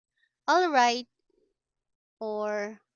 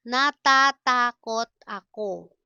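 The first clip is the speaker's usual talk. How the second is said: louder, separated syllables; 5 dB louder than usual. Shown as a demonstration. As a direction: sound out each syllable firmly. Slow model, then natural speed.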